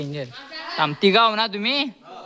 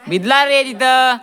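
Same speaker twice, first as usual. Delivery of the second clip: excited exclaim; 5 semitones higher, 5 dB louder than usual. Real, not acted. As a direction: stretch it out in excitement. Exclaiming at the game. (उत्साहाने लांबवत ओरडणे)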